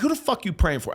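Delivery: high-pitched re-enacted angry demand; retold furious outburst. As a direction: act out blurting it in outrage. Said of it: "I'm saying this shit out loud".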